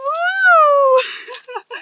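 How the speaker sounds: real startled whoop, high. Real, not acted. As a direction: yelp as the water surprises you. Falls into bog water.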